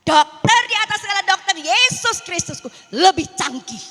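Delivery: loud, high, triumphant preaching; full-force preaching. Acted. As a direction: proclaim the miracle to the congregation. Proclaims Jesus as doctor. (khotbah berapi-api dengan suara keras)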